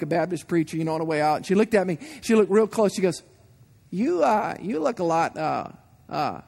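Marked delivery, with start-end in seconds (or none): 3.9-6.4 church lady. Retold or imitated. Church lady's blunt remark, mimicked.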